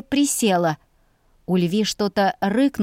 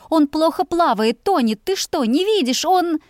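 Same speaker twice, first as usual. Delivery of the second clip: high, rising panic; panicked and climbing; 7 semitones higher than usual. A narrator's reading. Heroine panicking about her brother.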